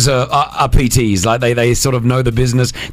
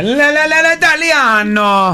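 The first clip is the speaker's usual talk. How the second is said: hammy sung Italian accent; high mock Italian singing; 11 semitones higher, slower than usual. Shown as a demonstration. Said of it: "speak with an Italian accent".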